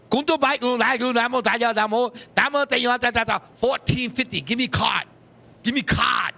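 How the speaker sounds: imitating a Chinese takeout owner's accent in gibberish; mock Chinese accent. Mock Chinese accent, the owner answering a customer. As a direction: rattle off fake Chinese, then demand the card. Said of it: "will turn around and go".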